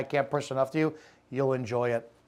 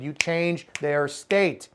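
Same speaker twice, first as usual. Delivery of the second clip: ordinary delivery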